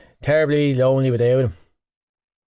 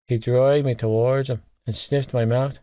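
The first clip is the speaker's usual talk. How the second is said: ordinary delivery, nothing marked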